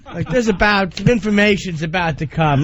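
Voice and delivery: usual speaking voice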